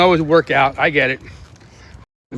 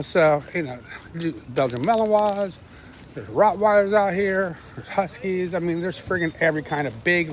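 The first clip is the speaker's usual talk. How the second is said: usual speaking voice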